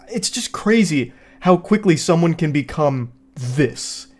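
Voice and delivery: ordinary talk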